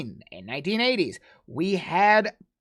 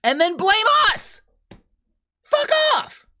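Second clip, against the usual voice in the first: much higher, louder furious yell; angry shout; 5 dB louder than usual. Real, not acted. Still venting at them, real furious shout. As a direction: spit it out in real rage. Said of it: "I'm angry".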